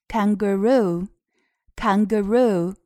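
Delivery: "kangaroo" slowly, syllable by syllable; careful model pronunciation. Shown as a demonstration. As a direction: say "kangaroo" slowly with a long final "roo". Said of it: "这个地方还要注意的是袋鼠".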